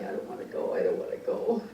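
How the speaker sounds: softly imitating a tearful refusal; mock a reluctant, tearful plea under the breath; retold crying plea. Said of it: "so he was crying".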